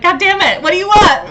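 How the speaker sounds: high, loud, passionate movie-lover plea; Noah from the Notebook. Retold or imitated. Imitating Noah pleading with his love, high and loud. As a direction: belt it like a desperate romantic lead. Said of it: "Like, Noah from the, from the Notebook".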